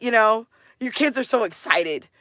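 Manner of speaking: usual speaking voice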